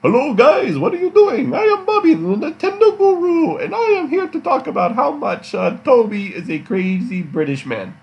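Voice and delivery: very high, loud squeaky caricature; shrill-pitched, loud teasing impersonation; mock Bobby voice. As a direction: squeal it like a cartoon of your friend. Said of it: "that's exactly how he sounds".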